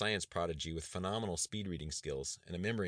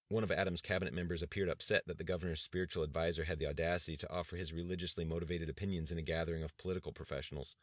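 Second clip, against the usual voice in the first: usual speaking voice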